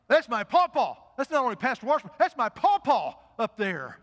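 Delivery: loud, high, driving sermon climax; full-force preaching. Acted. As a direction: preach it out to the whole church.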